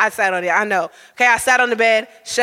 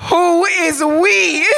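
slow, stretched out, laughing through it; laughing disbelief; 5 semitones higher, much slower than usual. Retold or imitated. Shells asking the creepy host's meaning, laughing in disbelief. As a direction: barely get it out for laughing.

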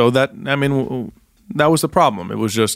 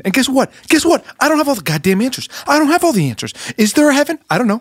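angry rant; 13 semitones higher than usual. Acted. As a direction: rage it out at the world. Priest ranting at the bar.

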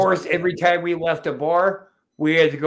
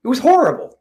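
higher, louder hammed-up complaint; mock outraged shout; 10 semitones higher, 9 dB louder, faster than usual. Host mock-yelling at his co-host, higher and louder. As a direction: blurt it out in fake outrage.

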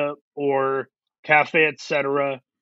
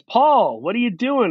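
imitated calling out; 7 semitones higher than usual. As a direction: call his name like hailing a friend. Acts out acquaintance calling to him.

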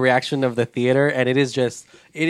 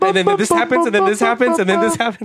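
excited retelling; 7 semitones higher than usual. Real, not acted. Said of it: "redo the entire scene".

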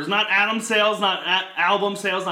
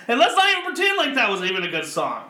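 usual speaking voice

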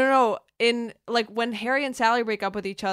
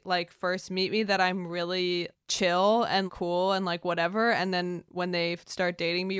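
normal talking voice

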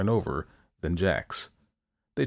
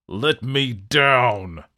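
loud, slow, drawn-out roar; 4 semitones higher, 6 dB louder, much slower than usual. A narrator's reading. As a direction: roar it down from the tree. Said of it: "the devil roared".

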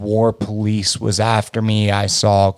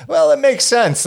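sudden realization. Retold or imitated. Teen accepting dad's punishment.

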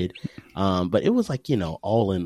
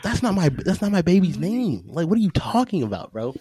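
indignant; 12 semitones higher than usual. Retold or imitated. Father correcting drunk cousin's song, high and outraged. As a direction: squeal up high in outrage at the wrong name. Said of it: "And I'm like".

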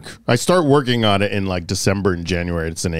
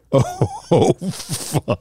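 shocked curse; 8 semitones higher than usual. Retold or imitated. Director's shocked reaction, retold.

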